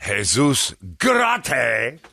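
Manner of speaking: slow, ringing call of the name; grand introduction. Pretend. Host thanking his friend on air, grand introduction. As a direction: draw out the name like an announcer.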